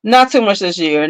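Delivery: ordinary talk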